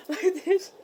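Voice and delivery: laughing gasp demo. Real, not acted. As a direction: gasp then crack up. Demoing choke, laughing.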